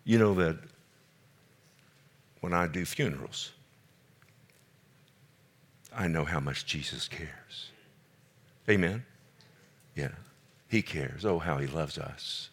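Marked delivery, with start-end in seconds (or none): 2.4-12.5 tender hush. Real, not acted. Pastor speaking tenderly of funerals.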